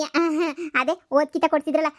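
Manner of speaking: ordinary talk, nothing marked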